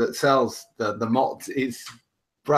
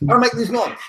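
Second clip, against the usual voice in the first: high-pitched, loud imitation of Brad; squeaky mock voice of an eager guy; imitating Brad; 11 semitones higher, 6 dB louder than usual. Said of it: "I won't do the South African accent".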